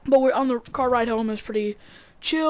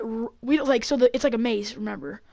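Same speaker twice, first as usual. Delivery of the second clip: usual speaking voice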